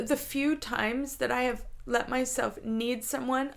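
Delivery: ordinary talk, nothing marked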